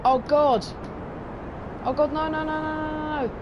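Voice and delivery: high, frantic repeated no's; panicked. Real, not acted. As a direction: panic as it all goes wrong. Craft goes wrong, panicking.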